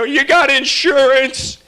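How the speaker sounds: high, loud pushy stranger; guy who rear-ended me. Retold or imitated. Driver shouting at me through my window, high and pushy. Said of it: "He goes".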